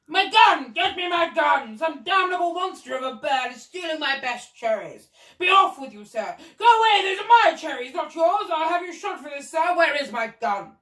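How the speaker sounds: high, loud blustering Duke; furious shouting. A narrator's reading. Duke raging at a bird stealing cherries, furious shouting. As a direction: rant at full volume, outraged. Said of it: "there was the Duke shouting".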